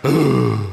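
a sheepish ooh to save face. Retold or imitated. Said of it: "I just kinda go".

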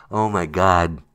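ordinary talk, nothing marked

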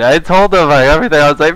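ordinary delivery

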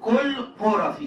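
كل بورافي with a falling close; careful pronunciation. Shown as a demonstration. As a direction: spell out كل بورافي for students, slow and emphatic. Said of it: "شايف النطق؟".

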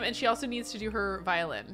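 normal talking voice

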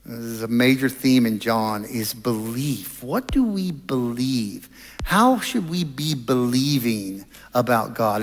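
normal talking voice

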